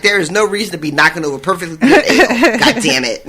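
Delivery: quick, laughing put-on scold; mock scolding. Sharna scolding the man who knocked over ale, laughing quickly. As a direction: scold the spill while cracking up.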